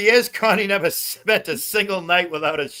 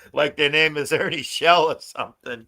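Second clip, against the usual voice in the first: ordinary talk, nothing marked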